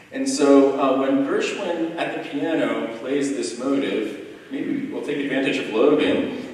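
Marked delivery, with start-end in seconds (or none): none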